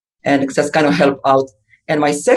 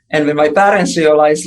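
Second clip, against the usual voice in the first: normal talking voice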